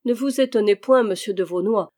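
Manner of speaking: plain reading voice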